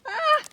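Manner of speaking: sudden high yelp of fright; scared scream. Pretend. Kid playing a pet, shrieking at the cat. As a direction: shriek as the monster lunges.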